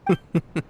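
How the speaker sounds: brief polite chuckle; light, reassuring little laugh; amused chuckle. Acted. Amused by friend's doubt.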